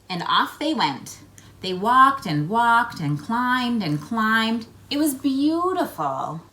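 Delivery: put-on lively read-aloud for young children; story narration. A narrator's reading. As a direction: tell it like a picture book to kids. Reads picture book aloud.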